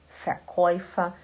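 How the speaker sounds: Verkäufer slowly, first sound an F: fair-koy-fer; slow model of Verkäufer with F. Shown as a demonstration. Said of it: "Tudo que tiver V no alemão, ele faz som de F".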